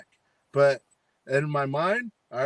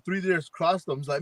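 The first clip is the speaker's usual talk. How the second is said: ordinary delivery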